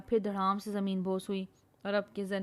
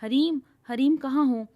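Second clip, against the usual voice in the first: calling her name loudly in worry; anxious call across the forest; 5 semitones higher, 6 dB louder than usual. Acted.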